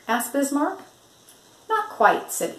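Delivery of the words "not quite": high, bright little bird voice; cheery egret. Acted. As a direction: pipe it up like a perky bird. Egret Igor's chirpy voice.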